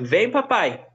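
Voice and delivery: voz fininha de criança pequena. Retold or imitated. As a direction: chamando o pai como uma menininha. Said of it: "E ela falava assim". (imitando a filhinha pequena)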